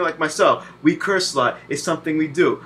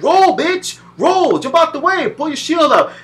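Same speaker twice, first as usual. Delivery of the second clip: high, loud frantic yelling at the controller; screaming button commands mid-fight; yelling commands at the game; 10 semitones higher, 4 dB louder, slower than usual. Retold or imitated. Gamer yelling at his character, frantic and high. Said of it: "You're like".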